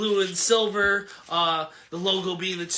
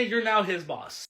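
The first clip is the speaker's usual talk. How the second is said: ordinary talk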